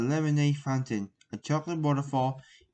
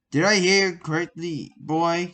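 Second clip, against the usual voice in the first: loud, threatening question; menacing; 4 semitones higher, 7 dB louder, slower than usual. Acted. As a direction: dare him to repeat it. Professor menacing after insult.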